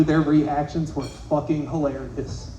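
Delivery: usual speaking voice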